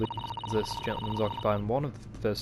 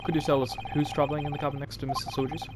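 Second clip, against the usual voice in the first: normal talking voice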